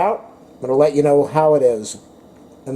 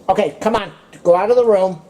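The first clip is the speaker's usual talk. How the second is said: shooing the dog; 5 semitones higher, 3 dB louder than usual. Real, not acted.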